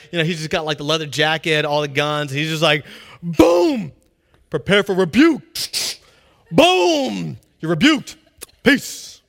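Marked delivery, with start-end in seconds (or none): 3.2-9.2 loud, booming action-hero voice; Terminator impression. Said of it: "Arnold Schwarzenegger in Terminator two".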